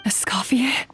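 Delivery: hushed, disbelieving whisper; astonished breathless whisper; whispered surprise. Acted. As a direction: whisper the name in hope. Hostage hears rescuer arrive.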